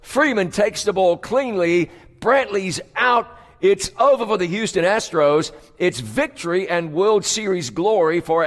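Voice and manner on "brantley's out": normal talking voice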